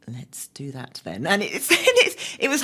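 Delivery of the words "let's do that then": conspiratorial slow whisper. Retold or imitated. Whispered to her friend, plotting the challenge. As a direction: hush it like a secret plan. Retold plan among friends.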